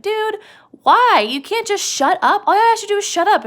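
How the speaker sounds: high-pitched, exasperated outburst; angry scolding. Acted. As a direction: chew him out, pitch jumping way up. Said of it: "He's so mad at him".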